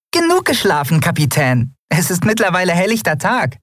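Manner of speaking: high, perky little creature; piping tiny voice. A narrator's reading. Tiny Nelen rouses her sleeping captain in a high piping voice. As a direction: chirp a cheeky wake-up call. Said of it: "piepste eine Stimme".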